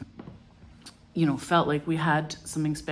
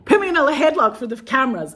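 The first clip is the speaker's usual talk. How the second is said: hammed-up gushing boss; 11 semitones higher, 9 dB louder than usual. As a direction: play the delighted boss, over the top and laughing. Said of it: "He was like".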